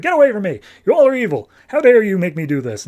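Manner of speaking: high, loud mock panic; freaking out. Mouse recoiling from the shower, mock freak-out. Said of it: "He's freaking out".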